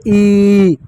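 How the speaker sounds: ई stretched long and loud; teacher showing long ई; long vowel demo. Shown as a demonstration. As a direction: draw out ई fully. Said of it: "इसका उच्चारण कैसे करेंगे".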